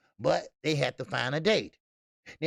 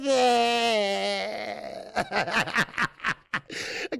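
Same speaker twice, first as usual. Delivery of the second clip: howling laugh; 5 semitones higher than usual. Real, not acted. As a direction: lose it laughing. Cracks up at Snap B comment.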